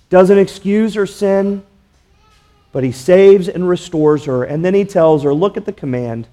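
ordinary talk, nothing marked

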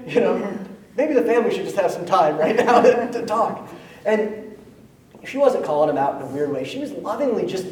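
ordinary talk

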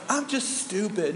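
lightly voiced mock whining; fishing for compliment. Compliment-seeker putting themselves down, mock self-pity. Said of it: "who's always fishing for a compliment".

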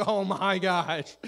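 high, loud, slow comic dismay; mock panic. Student under chancellor's tirade, hammed-up alarm. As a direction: react like an overwhelmed student.

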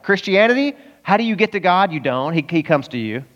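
ordinary talk, nothing marked

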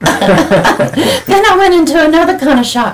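high, loud, laughing through the words; real delighted laughter; laughing disbelief. Real, not acted. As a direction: laugh out the shock. Laughing at her own shock.

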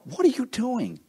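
high-pitched mock astonishment; incredulous onlooker. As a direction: ask it high in mock disbelief. Onlooker challenges the car pusher.

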